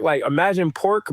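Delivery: usual speaking voice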